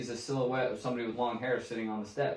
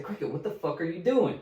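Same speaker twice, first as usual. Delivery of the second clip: retold irritated demand, pitched higher; 6 semitones higher than usual. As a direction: act out mounting irritation. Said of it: "he's getting fucking irritated".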